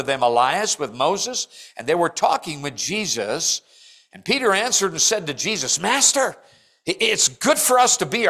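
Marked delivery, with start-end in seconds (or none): 5.8-8.3 excited and raised, pitched up high; Peter's eager inflection. Acted. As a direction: burst out like a thrilled disciple. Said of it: "I'm giving the inflection".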